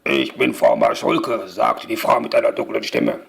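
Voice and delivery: very low, rough witchy neighbour; dark, rough voice. A narrator's reading. Neighbour introducing herself to Mia, deep and rough. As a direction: drop right down into a rough, dark register. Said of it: "noch nicht rau genug".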